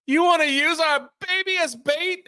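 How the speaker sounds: mother appalled by the plan; outraged mom. Retold or imitated. Mom voiced in an argument.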